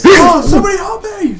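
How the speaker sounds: high panicked shout; desperate cry for help. Pretend. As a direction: scream for help. Voicing a dying animal.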